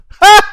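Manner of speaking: loud ha shout. Real, not acted. Loud exclamation.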